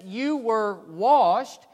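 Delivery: slow, loud emphatic preaching. Acted. Preacher urging the congregation on baptism, slow and loud. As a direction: drive each word home slowly.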